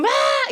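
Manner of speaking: high-pitched mock wail; imitated crying. Child left behind as the car door shuts, mock wail. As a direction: cry out like a kid left at school. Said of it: "eran niños llorando así mal".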